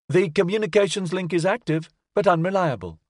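high, even machine report; a chirpy status report; robot voice. A narrator's reading. Robot voice held.

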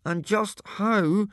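slow, high, squashed-face voice; high, drawn-out indignant question; squashed mouth. A narrator's reading. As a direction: ask it through one corner of a mashed mouth. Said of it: "out of one corner of his mashed mouth".

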